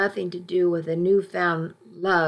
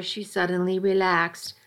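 usual speaking voice

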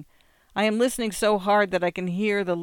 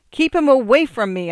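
higher and much louder shouted plea; scream; 7 semitones higher, 9 dB louder than usual. A narrator's reading. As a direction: scream it at mother. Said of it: "I scream at my mother".

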